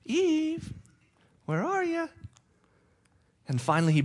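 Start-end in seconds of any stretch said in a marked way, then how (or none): 0.0-2.1 high, far-carrying call for the hiding pair; high-pitched divine call; God calling out. Acted. God searching for hiding couple.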